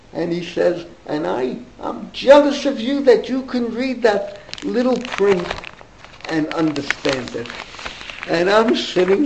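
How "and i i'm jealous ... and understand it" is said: put-on a touch of a German accent; lightly German, envious old man. Dentist telling the boy he envies him, German accent. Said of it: "with a German accent".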